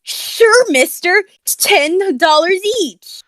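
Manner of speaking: high, slow, pompous tycoon; smug rich guy voice. Acted. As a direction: hand out bills like a smug millionaire. Rich man handing out cash.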